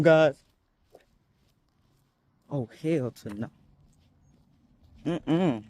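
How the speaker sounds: real fear, hushed; scared. Real, not acted. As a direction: murmur while eyeing a big dog. Scared of dog nearby.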